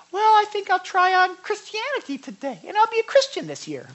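high, flippant, loud mock churchgoer; mock casual believer. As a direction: toss it off like a lukewarm dabbler.

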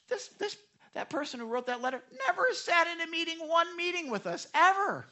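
very high-pitched indignant retelling; hammed-up squeak of indignation; exasperated disbelief. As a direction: protest in high, incredulous exasperation. Exasperated reaction to critical letters.

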